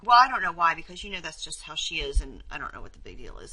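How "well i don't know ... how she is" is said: a loud impatient well; dismissive. Acted. Bad listener shrugs off the problem, dismissive. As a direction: cut her off with impatience.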